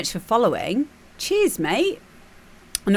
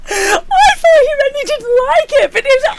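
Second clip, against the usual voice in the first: much higher, louder, laughing through tears; mock tearful contestant; 7 dB louder than usual. Contestant overjoyed at the verdict, mock crying. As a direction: overplay a relieved sob.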